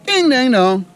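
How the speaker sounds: loud, high happy cheer; excited exclamation. Real, not acted. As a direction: celebrate the catch out loud. Cheers at news of a fish.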